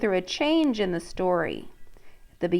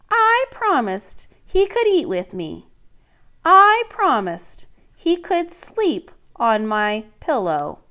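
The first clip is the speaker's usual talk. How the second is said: princess; 3 semitones higher, 4 dB louder, slower than usual. Acted. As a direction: refuse like a sulky princess. Princess's refusal in story.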